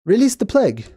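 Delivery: lightly acted, impatient lordly order; insistent repeated command. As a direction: repeat it firmly for a startled servant. God repeats order to startled butler.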